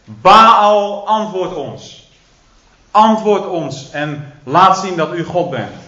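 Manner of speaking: loud pleading cry, drawn out on the name; calling out to Baal. Retold or imitated. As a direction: cry out to an idol, begging. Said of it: "die beginnen te roepen".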